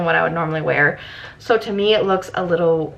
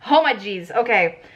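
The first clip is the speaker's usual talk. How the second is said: usual speaking voice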